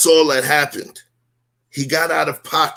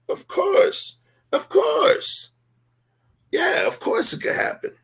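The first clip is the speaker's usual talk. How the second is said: real tears catching the voice, pitched much higher and breathless; voice breaking; slower than usual. Real, not acted. Answering whether reconciliation can happen, voice breaking with real tears. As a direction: emotion cracks through the words. Me getting emotional.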